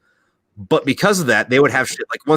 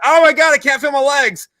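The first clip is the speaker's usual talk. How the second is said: much higher and louder hammed-up wail; mock panic; 7 dB louder than usual. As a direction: play the shot man, hysterical and comic. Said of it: "and I'm like".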